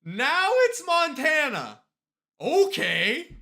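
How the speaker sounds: high, loud, slow flat statement; loud mock resignation. Real, not acted. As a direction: drag it out, fed up. Repeats the answer in mock resignation.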